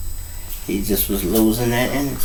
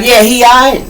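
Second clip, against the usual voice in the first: high, loud anxious question; retold urgent check; 12 semitones higher, 6 dB louder than usual. Asking if his shot friend is okay, high and urgent. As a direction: push it high, wanting good news.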